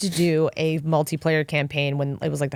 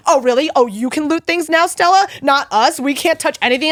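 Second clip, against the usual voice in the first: higher, louder mock indignation; sarcastic teammates; 7 semitones higher, 4 dB louder than usual. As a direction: throw her own rule back at her. Team mocks her looting.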